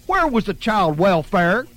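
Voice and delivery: put-on outraged on the baby's behalf; excited outrage. A narrator's reading. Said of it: "Imogene got all excited".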